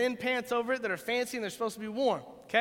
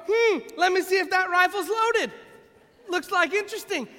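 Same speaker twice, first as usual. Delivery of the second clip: curious deer voice; 10 semitones higher, 7 dB louder than usual. Pretend. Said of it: "it didn't go".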